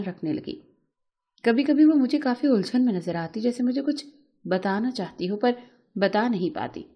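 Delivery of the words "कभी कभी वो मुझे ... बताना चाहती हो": usual speaking voice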